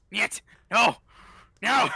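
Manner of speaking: frantic repeated no; panicked cries. Acted. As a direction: cry out in terror. Freaking out in red room.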